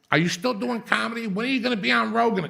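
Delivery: a lightly voiced starstruck kid; mock pestering fan. Kids pestering him at midnight, eager mock fan voice.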